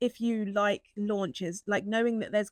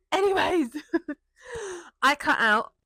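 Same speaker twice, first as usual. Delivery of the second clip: laughing; 7 semitones higher, 5 dB louder than usual. Real, not acted. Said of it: "Appreciation, that's what it is".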